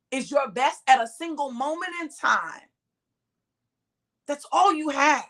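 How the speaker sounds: ordinary talk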